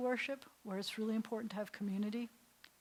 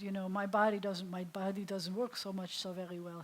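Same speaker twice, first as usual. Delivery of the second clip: ordinary talk, nothing marked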